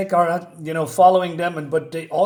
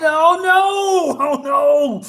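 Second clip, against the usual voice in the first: high, loud, slow dismayed wail; dramatic oh no; 11 semitones higher, 6 dB louder, much slower than usual. Real, not acted. Reviewer reacting to the falling queen, dramatic oh no. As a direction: draw out the dismay.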